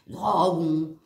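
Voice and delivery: low, stretched mock Franche-Comté accent. Trying out local speech for viewers, hammed-up Franche-Comté accent. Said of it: "s'il parle comme ça en Franche-Comté".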